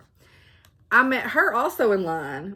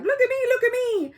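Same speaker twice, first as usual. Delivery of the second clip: much higher, louder whiny look-at-me voice; attention-seeking child; 5 dB louder than usual. Retold or imitated. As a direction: play the needy kid begging for eyes on you.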